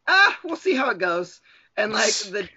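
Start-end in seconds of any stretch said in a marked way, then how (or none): 0.1-1.4 smug shrug. Retold or imitated. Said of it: "Vettel is like".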